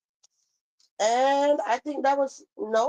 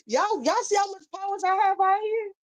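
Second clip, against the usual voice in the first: gloating; 10 semitones higher than usual. Real, not acted. Gloating to viewers about insult.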